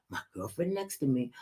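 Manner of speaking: ordinary delivery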